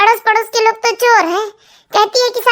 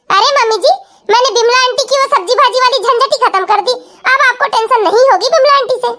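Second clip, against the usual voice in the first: ordinary delivery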